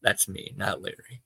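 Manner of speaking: usual speaking voice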